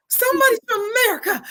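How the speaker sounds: very high, thrilled squeal; high, quick, shouted delight; retold excited shout. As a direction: re-enact an overjoyed discovery, voice shooting up. Retelling her thrilled exclamation.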